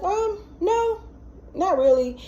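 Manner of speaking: high, hesitant little "um, no"; sheepish high reply. Retold or imitated.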